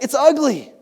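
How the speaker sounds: high, loud mock outrage; appalled protest. Husband complaining to his wife about a painting, appalled.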